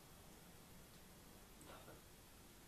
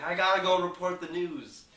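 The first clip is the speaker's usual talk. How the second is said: higher and louder, urgent; frantic shout; 9 semitones higher, 14 dB louder than usual. Retold or imitated. Ron running down the street shouting. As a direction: yell it while running flat out.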